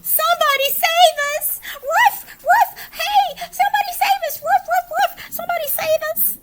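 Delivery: high, loud, fast panicked shrieks; shrieking dogs. Acted. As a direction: scream for rescue in a frenzy. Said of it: "shrieked the dogs".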